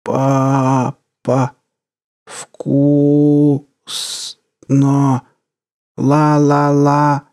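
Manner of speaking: put-on flat robotic child voice, slow la-la-la; half-mechanical voice. A narrator's reading. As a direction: sound like a broken doll. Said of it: "Полумеханический голос произнёс".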